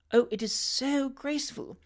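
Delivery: high, soft, admiring coo; dainty, delighted admiration; gushing young lady. A narrator's reading. Young lady gushing over skating.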